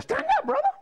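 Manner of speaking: very high put-on command; caricatured high-pitched summons; imitating the principal. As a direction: order it in a squeaky mock principal voice. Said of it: "he kinda talked".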